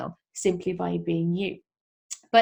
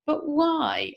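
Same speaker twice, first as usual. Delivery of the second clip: higher mock-child whine; whiny child; 8 semitones higher than usual. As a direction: play the pestering toddler. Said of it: "and they go".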